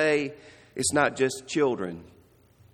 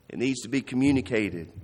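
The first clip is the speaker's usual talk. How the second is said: ordinary talk, nothing marked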